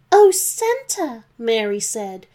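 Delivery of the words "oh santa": much higher slow woman's voice. Acted. Mary moved by Santa's proposal, high and slow.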